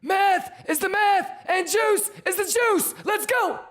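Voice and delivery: very high shouted clown chant; silly clown. Acted. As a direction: hype the chat like a cartoon clown.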